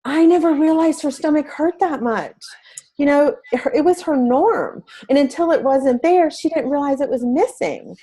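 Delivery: holding back crying as she remembers; near tears. Real, not acted. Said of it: "it just makes me cry".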